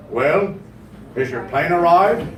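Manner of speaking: lightly voiced, low-pitched clerk; a hint of unimpressed official; deadpan baggage clerk. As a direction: answer a worried traveler with unhurried calm. Baggage clerk answers lost-luggage complaint.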